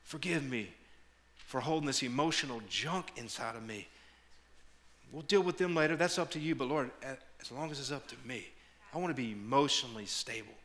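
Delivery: soft, low, earnest prayer; quiet prayer. Acted. Pastor reenacting his private prayer to the Lord, soft. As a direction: pray it softly, almost to yourself.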